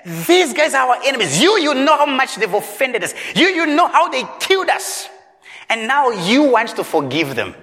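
an outraged prophet pleading his grievance; indignant Jonah. Acted. Preacher voicing an indignant Jonah.

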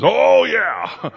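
high-pitched, gung-ho cheer; eager agreement. Retold or imitated. Man agrees to whatever God says, over-eager. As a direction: imitate a man bursting to say yes.

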